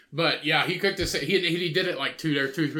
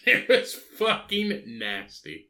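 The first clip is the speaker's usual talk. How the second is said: slow, higher, stretched out through laughter; laughing disgust; 7 semitones higher, much slower than usual. Real, not acted. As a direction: say it while cracking up in disgust.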